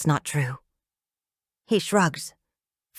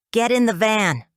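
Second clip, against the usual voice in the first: louder, curt order; bark; 5 semitones higher, 4 dB louder than usual. A narrator's reading. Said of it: "Roger barks".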